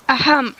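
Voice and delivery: polite throat-clearing; throat-clearing ahem. Acted. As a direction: clear your throat to get attention. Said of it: "I said again".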